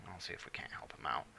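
whispered aside; under-the-breath whisper. Real, not acted. Muttered aside while playing.

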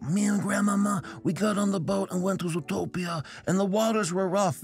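high-pitched mock Godfather storyteller; Mister Big. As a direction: recount it like a parody mob boss. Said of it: "the Marlon Brando character".

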